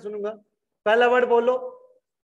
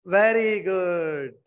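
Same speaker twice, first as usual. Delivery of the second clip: drawn-out praise; 3 semitones lower, much slower than usual. Acted.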